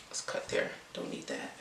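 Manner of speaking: murmured aside; whisper. Real, not acted. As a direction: whisper it to yourself. Unvoiced muttering aside.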